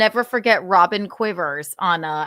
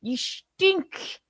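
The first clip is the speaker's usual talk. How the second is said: lightly voiced Indian scammer; Indian accent; 3 semitones higher than usual. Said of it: "everybody can do that Indian accent".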